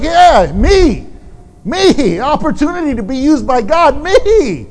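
loud, high, eager shout of me me me; eager volunteer. Retold or imitated. Isaiah answering God, loudly eager to go. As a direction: jump at the chance like a keen schoolboy. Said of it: "He's like".

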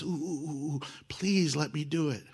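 soft, eager whisper-like begging; hushed pleading. Retold or imitated. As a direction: beg quietly so nobody wakes. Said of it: "they're whispering".

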